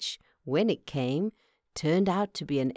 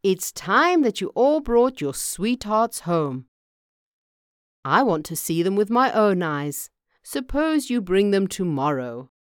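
loud, hearty farmer laying down a plan; decisive farmer; 3 semitones higher, 7 dB louder than usual. Acted. Farmer tells sons to bring sweethearts, decisive. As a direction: play a father loudly announcing his wish.

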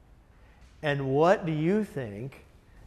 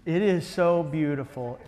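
ordinary talk, nothing marked

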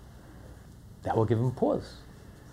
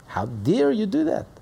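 high, sharp outraged rebuke; Moshe's rebuke; 10 semitones higher than usual. Acted. Moshe rebuking a sinner, high and outraged. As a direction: thunder it like an outraged prophet. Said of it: "Moshe got angry".

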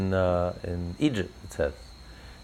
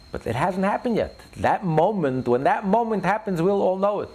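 preaching at full force; 11 semitones higher than usual. Acted. Rabbi proclaims to listeners that redemption has not come, full preaching. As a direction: declare it to the whole room.

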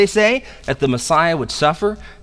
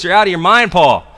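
raised voice, higher and forceful; loud exclamation; 5 semitones higher, 7 dB louder than usual. Acted. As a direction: exclaim it loudly in accusation. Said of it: "exclaimed in a loud voice".

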